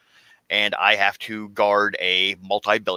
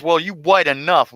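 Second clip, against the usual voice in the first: imitating the woman; 7 semitones higher, 5 dB louder than usual. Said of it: "and she goes".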